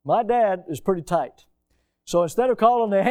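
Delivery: ordinary delivery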